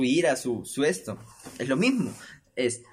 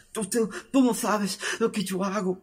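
raised, higher voice, stammering in rage; angry neighbour; 8 semitones higher than usual. Retold or imitated. Upstairs neighbour yelling at the man below, raised and higher. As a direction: snap back like a cornered man losing it.

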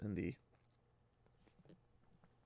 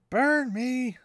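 much higher and louder, mock Mat curse; hammed-up Mat exclamation; 12 semitones higher, 8 dB louder than usual. Mat startled by the banner, hammed-up curse. Mat's catchphrase again.

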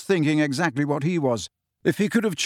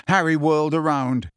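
usual speaking voice

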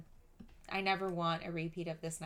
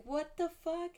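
shocked outburst; 11 semitones higher, slower than usual. Retold or imitated. Teller finds Edric asleep in the room, higher shocked cry. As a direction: blurt it out in disbelief.

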